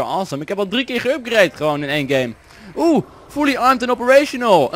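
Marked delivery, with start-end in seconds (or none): 2.8-4.7 high, dramatic mock announcer. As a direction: announce it grandly. Nadoen van spelstem. (hoge gespeelde omroeperstem)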